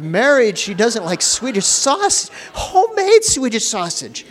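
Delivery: high, loud and rising in hammed-up alarm; mock inward panic. Husband inwardly panics that his bride dislikes the sausage, in high hammed-up alarm. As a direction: squeal it out in comic horror at the bride. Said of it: "Inwardly, I went".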